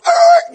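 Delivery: high, loud dog noise; loud mimicked yelp; imitated dog bark. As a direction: bark like a startled house dog. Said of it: "the dog goes".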